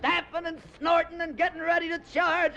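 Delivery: ordinary talk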